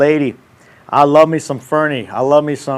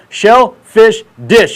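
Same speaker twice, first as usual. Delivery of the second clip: slow, loud, higher shellfish dish; over-enunciated at half speed; 6 semitones higher, 6 dB louder, much slower than usual. Shown as a demonstration. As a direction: spell it out slowly, word by word. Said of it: "You gotta say it slow".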